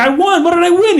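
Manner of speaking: very high, eager squeal of a question; mock-thrilled, voice leaping up; excited winner. Acts out excited contest winner.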